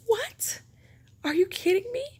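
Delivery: high, squeaky disbelief; surprised squeal. Retold or imitated. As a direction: squeal it like you can't believe your luck. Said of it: "I was like".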